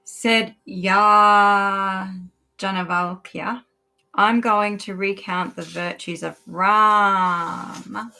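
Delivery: slow recital with drawn-out names; measured storytelling from a sacred text; scripture reading. A narrator's reading. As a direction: read aloud solemnly, stretching the key words. Reads scripture aloud.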